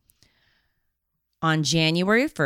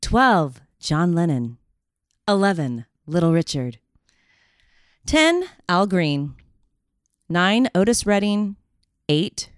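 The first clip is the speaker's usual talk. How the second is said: big awards-show announcer voice; countdown announcer. Pretend. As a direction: call out each name like an MC. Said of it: "You got that voice".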